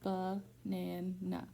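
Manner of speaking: slow "ba-nan-na", a gap between each syllable. Shown as a demonstration. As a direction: break "ba-nan-na" into three separate, evenly spaced beats. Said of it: "That word has three syllables".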